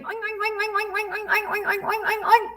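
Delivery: high, playful oink oink oinks; high piggy oinking for the kids. Shown as a demonstration. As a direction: squeal them out like a little piggy. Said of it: "I know you're saying".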